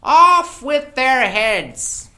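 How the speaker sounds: very high, very loud, slow screech; screaming Queen. Acted. The Queen screams her sentence on the gardeners.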